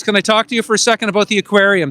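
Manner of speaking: usual speaking voice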